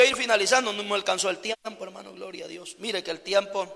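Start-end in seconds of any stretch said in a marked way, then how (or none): none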